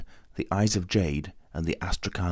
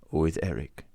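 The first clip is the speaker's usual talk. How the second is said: lower, falling, in the Persian's voice; grim confirmation; 3 semitones lower than usual. A narrator's reading. The Persian confirming to Raoul, grim falling answer. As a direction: confirm it flatly and gravely.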